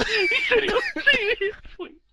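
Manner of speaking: high, loud laugh through words. Real, not acted. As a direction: laugh it out in disbelief. Laughing disbelief.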